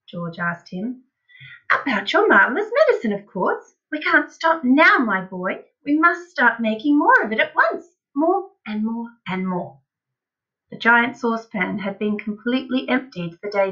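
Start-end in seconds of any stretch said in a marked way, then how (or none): 1.7-9.8 eager, high-pitched enthusiasm; excited father. A narrator's reading. Father urging his boy to make more medicine, excitedly. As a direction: urge him on with swooping emphasis. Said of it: "in a state of greater excitement".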